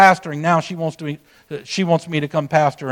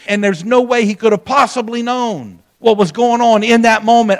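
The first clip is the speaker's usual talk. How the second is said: ordinary talk, nothing marked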